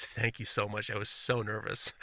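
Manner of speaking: nervous student thanking the patient, softly; relieved student. Retold or imitated. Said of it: "And he goes".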